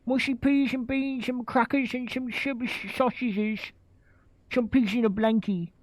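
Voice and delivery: high, fast, hammed-up British accent; mock British accent. Mocking Estonian cooks imagining British food, exaggerated British accent. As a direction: lay on a cartoon Cockney British accent to mock them. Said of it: "They're like".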